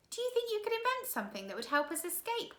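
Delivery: put-on gaze down in awe as a boy; awed boy. Klaus voiced.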